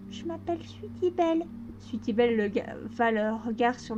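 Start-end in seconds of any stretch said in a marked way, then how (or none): 0.1-1.4 higher, softer shy little pony; a hint of a shy young girl; shy filly voice. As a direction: answer timidly like a small filly. Sweetie Belle's voice.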